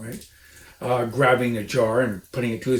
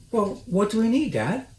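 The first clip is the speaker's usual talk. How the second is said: put-on child fox asking; 9 semitones higher than usual. A narrator's reading. The smallest fox asks his dad about turkeys. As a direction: sound like an eager little cub.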